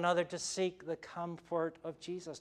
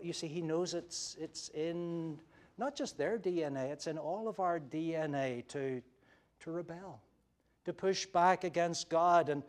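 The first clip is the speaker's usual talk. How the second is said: ordinary talk, nothing marked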